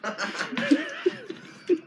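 shrill laugh of disgust; high squealing laugh. Real, not acted. After the rotten egg bean.

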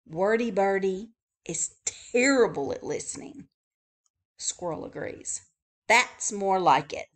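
usual speaking voice

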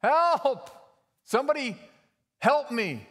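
high, loud, drawn-out cries for help; acted high-pitched shouts for rescue; panicked yelling for help. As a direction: call up desperately to anyone who can hear. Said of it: "he's yelling".

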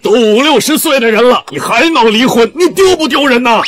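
high-pitched, heated rebuke; shaming, worked-up reproach; indignant scolding. Acted. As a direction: berate with rising outrage. Husband berates wife over divorce.

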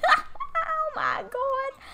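high laughing burst. Real, not acted. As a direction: laugh through the words. Laughing at game characters.

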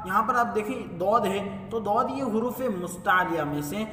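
usual speaking voice

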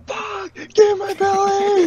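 high, drawn-out hammed-up scream; mock scream. Shown as a demonstration. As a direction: scream it slowly, way over the top. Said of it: "Just, just absolutely scream".

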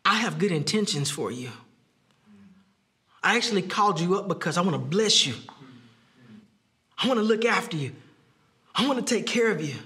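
gently calm a frightened guest; calming reassurance. Retold or imitated. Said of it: "Yet David calms him".

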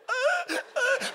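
high mock wail; hammed-up, high-pitched bawling; imitated child crying. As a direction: wail like a crying kid, put on.

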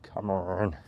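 soft, whispered plea to the screen; hushed mutter under the breath; muttered impatience. Real, not acted. Muttering at unresponsive comment screen.

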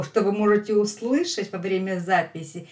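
normal talking voice

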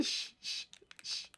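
unvoiced hushing; breathy shh to keep quiet; whispered shush. Real, not acted. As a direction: hush them urgently. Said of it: "hushing others while hiding".

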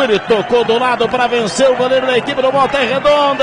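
ordinary talk

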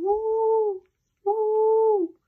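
high, loud ghostly woo; howling woo. A narrator's reading. Girl imitating the dog's howl, high ghostly woo.

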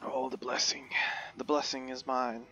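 ordinary talk

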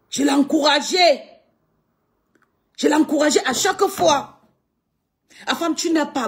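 loud, high insistent cry; indignant shout. Real, not acted. Ranting at a critic online, shouting. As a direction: shout it out so everyone hears. Angry live rant at critic.

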